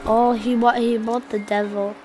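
ordinary talk, nothing marked

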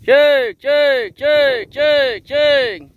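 high, loud call to the herd; calling horses. Real, not acted. Farmer calling his mares in from the pasture, loud high call. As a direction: sing out to bring the mares in.

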